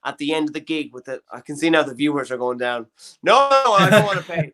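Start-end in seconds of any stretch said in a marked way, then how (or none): 3.2-4.5 louder, higher mock protest; a hammed-up refusal to pay. Acting stingy viewer.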